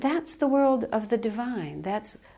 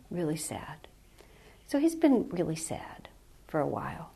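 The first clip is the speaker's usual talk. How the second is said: hushed sadness; 2 semitones lower, 6 dB quieter than usual. Real, not acted. Whispering about a grieving friend's sadness. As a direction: drop to a whisper on the sad part.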